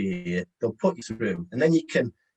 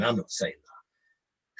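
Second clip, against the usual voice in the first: slow, mock reluctant mumble; cringing refusal; 4 dB quieter, much slower than usual. Trainee cringing at the sales advice, mock reluctant. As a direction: imitate a trainee who won't say it. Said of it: "or you're cringing and going".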